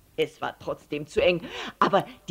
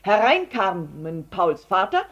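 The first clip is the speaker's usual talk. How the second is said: ordinary delivery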